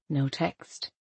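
plain reading voice

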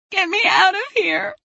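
put-on very high, desperate child's scream. A narrator's reading. Maribel begs her mother for rescue, screaming. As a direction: cry for rescue, high and panicked. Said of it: "Maribel screamed".

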